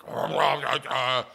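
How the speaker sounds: loud mock grumble of outrage; angry spluttering. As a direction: splutter wordlessly, imitating a fuming man. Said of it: "He was so pissed off".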